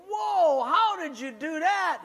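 high, loud astonished cry; amazed whoa. Acted. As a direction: gasp in amazement at the trick. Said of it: "The other two guys go".

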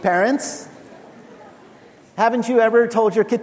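ordinary delivery

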